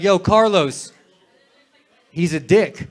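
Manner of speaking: loud, projected jibe; mock calling out. Comic acting out insulting the host, loudly called. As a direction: call it across the room.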